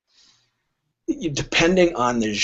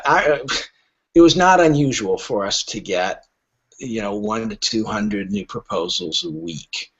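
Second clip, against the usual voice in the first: ordinary talk, nothing marked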